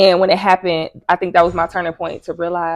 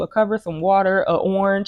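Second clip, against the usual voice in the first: ordinary delivery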